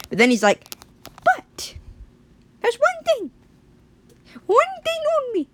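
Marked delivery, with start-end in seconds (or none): 1.2-5.5 very high, squeaky bargainer; tiny cartoon villain voice; scheming talking branch. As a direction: squeak out a sinister deal. Branch names its price.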